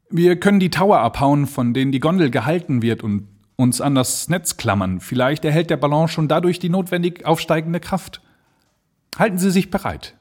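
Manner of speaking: höher, drängender Luftschiffer; urgent plan. Acted. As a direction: schnell und entschlossen. Blanchard proposes cutting the ropes. (Blanchard zu Jeffries, hoffnungsvoll drängend)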